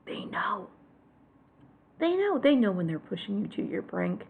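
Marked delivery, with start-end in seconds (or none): 0.1-0.7 whisper. Real, not acted. Conspiratorial aside.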